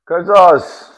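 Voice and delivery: sudden loud yelp of the snake's name; startled yell. Real, not acted. Snake wraps the mic, owner yelps its name loudly.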